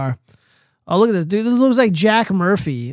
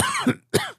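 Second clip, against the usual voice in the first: harsh coughing fit; 6 dB quieter than usual. Real, not acted. Drink went down wrong pipe.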